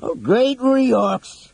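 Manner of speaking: high, drawn-out groaned oath; groaning. A narrator's reading. Flint as horns blast outside, groaning. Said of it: "Flint groaned".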